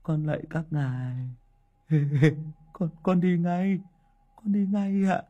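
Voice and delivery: high, frightened pleading; terrified mumble. Acted. Hùng begging the ghost soldiers, high and frightened. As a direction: cower and beg the spirits to spare you. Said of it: "miệng lẩm bẩm".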